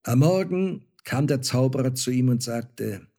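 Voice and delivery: plain reading voice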